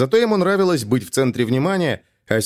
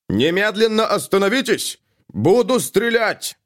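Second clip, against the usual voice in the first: high, slow commanding shout; police shout; 10 semitones higher than usual. A narrator's reading. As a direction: order him to stop.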